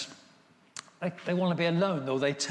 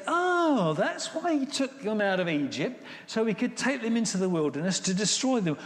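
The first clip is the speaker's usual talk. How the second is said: hammed-up knowing crowd voice, higher; mock realisation; 8 semitones higher, 3 dB louder than usual. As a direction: act out onlookers jumping to a conclusion, pitched up. Egyptians' scornful realisation, voiced.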